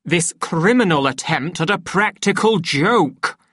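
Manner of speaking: angry public outburst; outraged shout. A narrator's reading. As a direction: bellow it in affronted disbelief. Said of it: "the director shouted".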